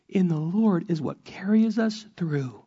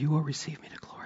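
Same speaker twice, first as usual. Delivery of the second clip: whisper; 7 dB quieter, much faster than usual. Acted. Closing psalm line.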